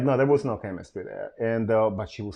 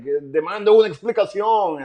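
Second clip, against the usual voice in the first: high mock demand in Spanish; imitated Spanish-speaking ex; 6 semitones higher, 3 dB louder than usual. Ex demanding an explanation in Spanish, comically imitated high. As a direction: play her Spanish demand for laughs. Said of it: "in Spanish it's funnier 'cause she's like".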